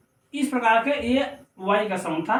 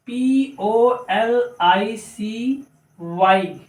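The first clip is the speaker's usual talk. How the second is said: very slow, letter by letter; spelling out; much slower than usual. Shown as a demonstration. Teacher spelling policy for learners, slow letters. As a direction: separate each letter with a small gap.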